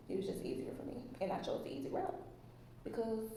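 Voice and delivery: whispered confiding aside. Acted. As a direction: whisper it like a secret. Confiding aside about easy choice.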